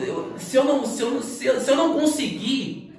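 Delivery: high, halting retold pleading; stammering plea. Applicant begging the manager for a test, mock desperate stammer. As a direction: stumble over the words, pitched well up.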